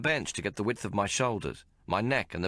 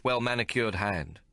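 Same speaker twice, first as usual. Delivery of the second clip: plain reading voice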